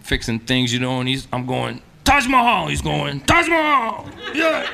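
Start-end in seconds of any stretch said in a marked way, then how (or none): 2.0-2.7 high, proud announcement; excited boast. Retold or imitated. Telling the landlord. 3.2-4.7 high, impressed repeat; excited landlord echo. Retold or imitated.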